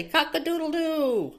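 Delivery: high playful rooster cock-a-doodle-doo; rooster crow. Retold or imitated. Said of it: "He'll say".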